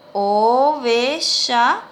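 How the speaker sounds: drawn out syllable by syllable; slow dictation. Shown as a demonstration. Teacher dictates the word to pupils, very slowly. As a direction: stretch every vowel for dictation.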